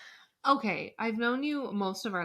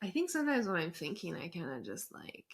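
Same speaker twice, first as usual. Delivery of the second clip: trails off much softer; fading voice; 4 semitones lower, 8 dB quieter than usual. Shown as a demonstration. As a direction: let the voice fade away. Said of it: "It fades off into the distance".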